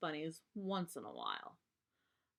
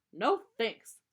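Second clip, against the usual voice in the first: quick refusal; 3 semitones higher, 8 dB louder than usual. Acted. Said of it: "said Brother".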